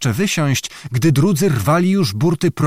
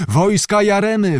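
alarmed call; 8 semitones higher than usual. A narrator's reading. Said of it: "wołano na promie".

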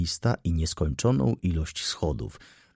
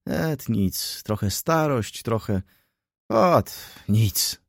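put-on higher, broken phrases of a winded old friend; out of breath; 4 semitones higher, much slower than usual. A narrator's reading. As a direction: brush it off while short of breath. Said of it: "zdyszany upadł na krzesło i z trudnością mówił".

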